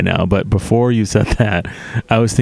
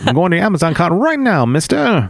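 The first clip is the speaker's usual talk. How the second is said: chipper assistant voice; 10 semitones higher than usual. Pretend. Playing the earbud assistant.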